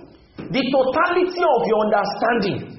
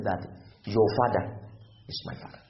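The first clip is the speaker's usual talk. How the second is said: soft reveal whispered at the end; hushed reveal; 9 semitones lower, 7 dB quieter than usual. Real, not acted. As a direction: voice falls away to a whisper on is my father. Preacher drops to a hush.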